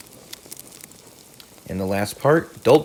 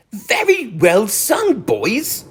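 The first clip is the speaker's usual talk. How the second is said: a lightly raised, hearty Rat, slow on the praise; 11 semitones higher, much slower than usual. A narrator's reading. Rat praising the caroling field mice, hearty. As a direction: call out warm praise to the singers. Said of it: "cried the rat heartily".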